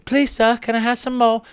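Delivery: put-on small, high, soft child's plea; begging orphan kid. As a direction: beg timidly in a put-on orphan voice. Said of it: "it's like a kid at a".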